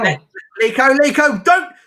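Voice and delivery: high, loud warning to a player; urgent shout. Real, not acted. Urging player during live match.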